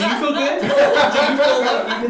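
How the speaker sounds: laughing, high and loud; cracking up while asking. Real, not acted. DM laughing at player.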